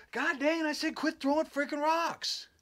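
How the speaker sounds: very high-pitched, exasperated mock holler; imitated furious yell. Les yelling at unseen rock throwers, imitated fury. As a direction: act out a friend who has had enough. Friend angrily yells at thrower.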